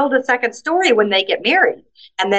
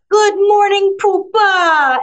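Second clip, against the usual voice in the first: put-on high, loving sing-song greeting to a child; warm teacher greeting; 9 semitones higher, 3 dB louder, much slower than usual. Teacher welcoming a pupil at the school gate, high and loving. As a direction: open your arms and coo it at a little one. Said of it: "they'd hold their arms open and they'd go".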